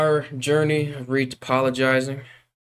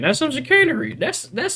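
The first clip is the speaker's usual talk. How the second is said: high squeaky take on "chicanery"; mock squeaky; 3 dB louder than usual. Real, not acted. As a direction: squeak out "That's some chicanery" in a silly voice. Repeats the phrase mockingly.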